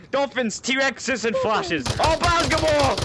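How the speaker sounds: high, loud, gleeful slow listing; delighted, rising excitement; excited. Real, not acted. Delighted by chaos in game.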